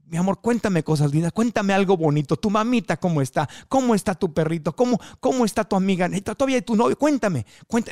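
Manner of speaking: light imitation of a doting actress; imitating Laura León. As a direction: coax someone into good news, doting. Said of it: "Esa era su línea".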